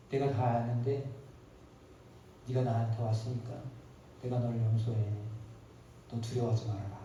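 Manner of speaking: low, soft, gentle reassurance; tender forgiveness. Acted. God forgiving the returning Cain, soft and low. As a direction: soothe him like a forgiving father.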